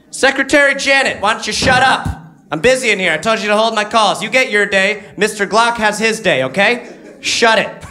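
high, loud, dismissive boss barking orders; rude boss voice. Acted. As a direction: snap at the secretary from up high. Comedian acting a rude boss.

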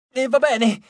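high, loud yelp of surrender; shrill giving in. A narrator's reading. Dwarf yelps his surrender to Jason, high and loud. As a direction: squeal it out with a sword at the throat. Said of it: "strepitò il nano".

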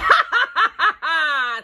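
high evil witch cackle; wicked cackle. Acted. Witch gloating over Hansel and Gretel, evil cackle. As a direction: cackle with glee over the children. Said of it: "she laughed wickedly".

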